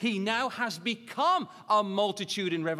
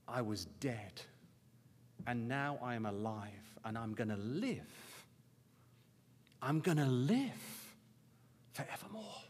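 low, hushed, moved declaration; quiet awe, with lingering pauses; 5 semitones lower, 4 dB quieter, slower than usual. Real, not acted. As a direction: say it softly and low, deeply moved. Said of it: "It's just moving thinking about it".

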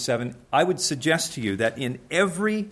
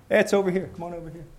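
lightly voiced false helper; 4 semitones higher, faster than usual. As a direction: friendly fake call, fading at the end. Deceiver misleads a blind man.

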